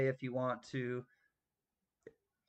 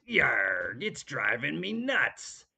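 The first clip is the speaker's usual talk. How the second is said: loud gruff pirate punchline, slowed; 5 dB louder, slower than usual. Retold or imitated. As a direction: deliver the punchline as a rough pirate. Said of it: "And the pirate says".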